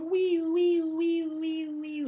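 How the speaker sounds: high-pitched squealy wees; squealing girl imitation. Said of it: "like that girl from that awful Kung Pao".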